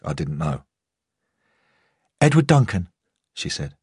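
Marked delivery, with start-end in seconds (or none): 2.2-2.9 higher-pitched female character voice; lightly voiced woman on the phone. A narrator's reading. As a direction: say it higher, as a woman announcing a name.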